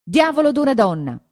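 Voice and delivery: high, loud fed-up outburst; loud annoyed cry; exasperated exclamation. A narrator's reading. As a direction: shove off a clinging woman. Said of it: "esclamò Perpetua".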